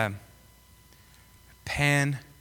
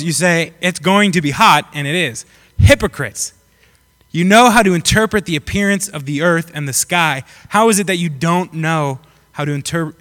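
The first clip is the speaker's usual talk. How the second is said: measured, emphatic Bible reading; scripture reading; 5 dB louder than usual. A narrator's reading. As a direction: read it out firmly to the congregation. Reads scripture aloud.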